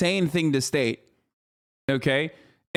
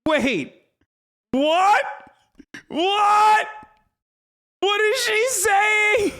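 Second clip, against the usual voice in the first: sudden high shocked cry, stretching the question out slowly; can't believe what he just heard; stunned disbelief; 4 dB louder, much slower than usual. Real, not acted. Streamer reacting to a pundit's claim, in high shocked disbelief.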